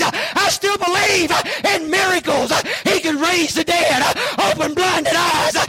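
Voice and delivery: high, impassioned declaration rising to a shout; fervent preaching. Real, not acted. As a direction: hammer out each belief in a revival cadence. Preaches creed at full force.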